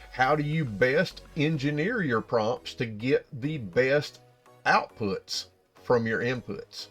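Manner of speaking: ordinary talk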